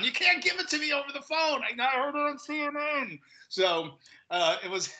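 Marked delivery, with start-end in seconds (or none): 0.0-3.2 high-pitched mock panic; mock meltdown. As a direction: imitate a caller losing it, pitched up. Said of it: "has a complete meltdown".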